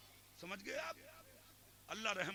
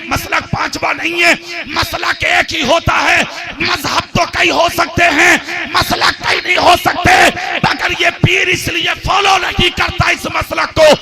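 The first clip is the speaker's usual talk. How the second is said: much louder sermon delivery; full-force preaching; 8 semitones higher, 27 dB louder than usual. Acted. Preacher telling the crowd the dream story, roaring sermon. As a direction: roar the tale over the gathering.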